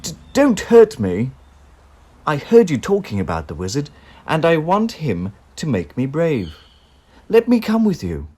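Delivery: loud, high, stammering plea; frightened lion. A narrator's reading. Cowardly lion begs the friends, frightened and loud. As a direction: beg them not to hurt you. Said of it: "yelped the lion".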